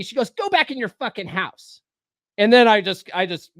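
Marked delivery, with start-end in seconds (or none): 0.3-1.8 imitated yelling. Neighbor ordering the man back inside, mild mock yell. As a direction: mimic the neighbor's angry order. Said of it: "she yells at me".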